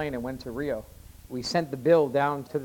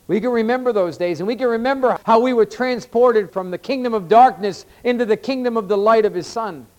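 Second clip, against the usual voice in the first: raised, insistent sermon delivery, swelling toward the end; impassioned preaching; 5 semitones higher, 8 dB louder than usual. Real, not acted. Preacher urges believers to remember days before salvation, impassioned preaching. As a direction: build the repeated phrases with rising force.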